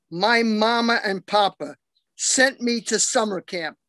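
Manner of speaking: ordinary talk, nothing marked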